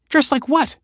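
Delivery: a lightly voiced indignant snap; snapping. A narrator's reading. Young woman snapping at Michael about her clothes. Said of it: "snapped Kelsey".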